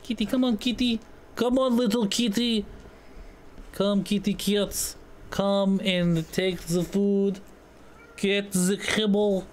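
put-on slow, coaxing Russian accent; drawn-out Russian-accented coaxing. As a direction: call a cat in a mock Russian accent. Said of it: "what's cat in Russian?".

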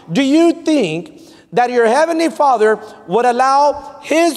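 high, insistent pulpit delivery with stretched key words; impassioned preaching. Real, not acted. Preacher asking the church if the Father would allow this, impassioned preaching. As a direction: press each rhetorical question on the congregation.

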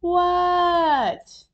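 high, long-drawn shouted reply; stretched-out high yell, falling at the end; hollered answer. A narrator's reading. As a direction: holler back to a calling parent. Froggy answers mom's call.

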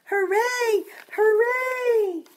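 high, loud cheering hooray; children shouting hooray with joy. Acted. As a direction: cheer it out like a happy crowd of kids. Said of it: "the children cried".

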